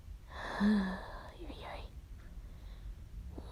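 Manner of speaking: long, soft acted sigh; drawn-out quiet exhale; exasperated sigh. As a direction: sigh heavily before complaining. Exasperated character before why.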